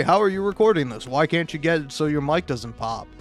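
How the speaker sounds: normal talking voice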